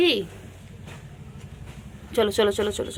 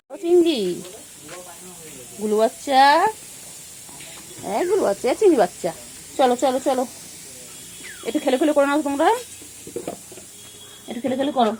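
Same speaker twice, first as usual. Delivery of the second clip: high excited coaxing; 7 semitones higher, faster than usual. Real, not acted. Owner urging the pets to play together, high excited coaxing. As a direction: cheer the pets on eagerly.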